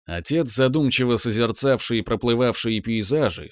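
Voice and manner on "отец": ordinary delivery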